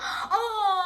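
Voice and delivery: playful fake fright; mock scared gasp. Shown as a demonstration. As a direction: gasp as if frightened by an angry child. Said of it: "Nuni is so angry".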